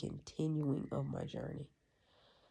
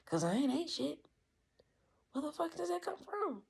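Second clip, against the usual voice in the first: pitched way up in disbelief; incredulous; 13 semitones higher, 4 dB louder than usual. Retold or imitated. Staring at his bucket, he asks in high-pitched disbelief. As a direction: squeak it out, can't believe it.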